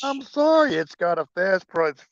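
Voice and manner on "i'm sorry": ordinary delivery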